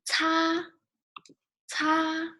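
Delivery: "Ca" held long, high and level; first tone. Shown as a demonstration. Teacher modelling the syllable for learners, high flat first tone. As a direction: sustain "Ca" flat after an airy ts. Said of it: "this one, first tone".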